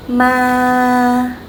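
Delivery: "ma" held long on one level pitch; flat tone. Shown as a demonstration. Teacher demonstrating Vietnamese no tone, flat level "ma". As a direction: say "ma" flat and drawn out, no movement. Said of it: "keep your voice flat".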